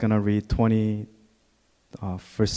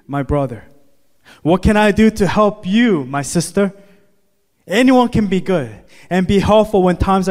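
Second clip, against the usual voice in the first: higher, louder preaching that keeps building; full-force preaching; 11 semitones higher, 7 dB louder than usual. Acted. Pastor challenging the church on helping others, preaching hard. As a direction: drive it home to the congregation.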